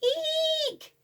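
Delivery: high mock fright squeal; mock squeak. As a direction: squeal like a scared child. Fright at the shape.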